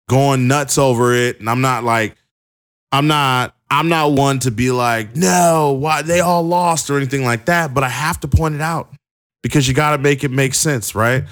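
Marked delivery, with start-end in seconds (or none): 5.2-6.9 pitched up and slowed, a mock fan whining; mock whiny protest. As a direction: play the upset fan, dragging it out. Mock reaction of upset fans.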